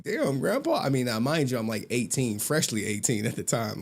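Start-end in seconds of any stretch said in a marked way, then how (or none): 0.0-0.8 high-pitched retold dismay; mock-wounded disbelief; taken-aback grandkid. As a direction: react to grandpa's harshness, pitched up. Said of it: "I was like".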